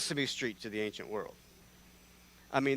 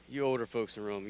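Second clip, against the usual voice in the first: ordinary delivery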